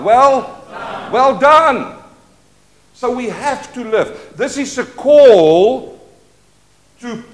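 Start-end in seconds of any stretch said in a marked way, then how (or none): 0.0-2.0 shouted. Shown as a demonstration. Preacher proclaiming God's praise to the church, loud shout. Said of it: "shout it out".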